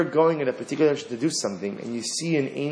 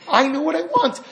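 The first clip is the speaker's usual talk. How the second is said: much higher, insistent mock voice; stubborn Bilam; 14 semitones higher, 3 dB louder than usual. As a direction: play the single-minded Bilam.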